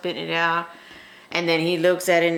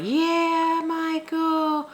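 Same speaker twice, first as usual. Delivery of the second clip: cheering; 13 semitones higher, much slower than usual. Retold or imitated. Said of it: "they're like".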